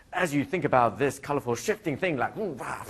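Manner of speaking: high, showy hypnotist spiel; hammed-up trance patter with wowed noises; mock NLP patter. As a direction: oversell it like an eager NLP novice. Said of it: "I was still in my N L P days".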